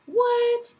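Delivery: high, incredulous "what?"; baffled little boys. Acted. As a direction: squeak it like a confused little boy. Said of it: "Even those little boys like".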